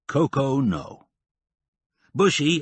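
usual speaking voice

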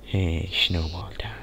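conspiratorial whisper; playful whisper. Real, not acted. Gamer sneaking a snowball attack, playful whisper. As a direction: whisper the plan with glee.

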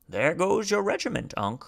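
high, lilting taunt; teasing taunt. A narrator's reading. Man points out Unc's departed regiment, high teasing taunt. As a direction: lift the voice high, teasing him.

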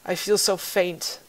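high, soft, faint and failing voice; frail old woman. Acted. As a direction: call out weak and dull-sensed. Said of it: "you hear the voice call out once again".

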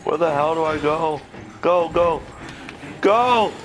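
high loud panicked yells; panicked shouting. Real, not acted. Panicked fleeing from enemy.

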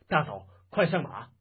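urging shout in narration; urgent call. Acted. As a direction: call out to hurry them along. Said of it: "叫道".

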